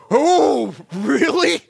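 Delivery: high, loud startled "Oh, really?"; startled surprise. Retold or imitated. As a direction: caught off guard, voice jumps up loud. Hearing he sprayed their house.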